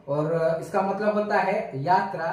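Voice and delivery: normal talking voice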